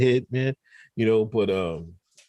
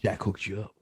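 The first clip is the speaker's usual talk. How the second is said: dropped low and softer, a sly aside; 7 semitones lower, 9 dB quieter than usual. Pretend. Teasing the blue check winner, a low sly aside. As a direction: mutter it low and knowing.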